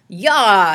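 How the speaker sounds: loud sharp yell; battle shout. A narrator's reading. Morton blinds Annabelle with the flashlight, shouting. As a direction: blast it at her. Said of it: "he shouted again".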